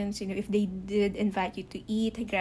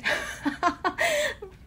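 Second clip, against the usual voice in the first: sheepish laugh; 12 semitones higher than usual. Real, not acted. Embarrassed after rambling explanation.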